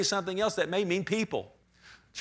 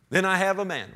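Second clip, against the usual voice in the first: normal talking voice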